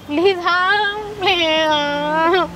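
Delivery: drawn-out, sobbing plea; tearful pleading. Acted. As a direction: beg through sobs, stretching each word. Daughter begs as mother leaves.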